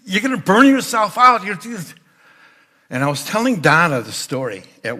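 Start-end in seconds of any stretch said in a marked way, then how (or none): 0.1-1.9 high, loud anxious warning; worried husband. Retold or imitated. Husband warning his wife she will burn out. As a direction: warn her off, alarmed. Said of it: "I'm going".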